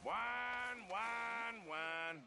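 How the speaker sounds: drawn-out mock whining, falling off at the end; mocking whine. [name] mimics a companion's complaining, mocking whine. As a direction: imitate a whiner to needle them. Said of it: "That's what you sound like".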